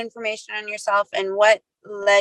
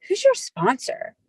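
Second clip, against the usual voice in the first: awed question; 13 semitones higher than usual. Retold or imitated. Mock awed question to a shining person.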